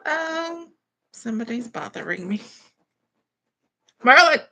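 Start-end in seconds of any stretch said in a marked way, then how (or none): 4.0-4.4 a loud call to Marlon across the room. Real, not acted. Mother calling out to someone off camera, loud. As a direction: call him over, louder.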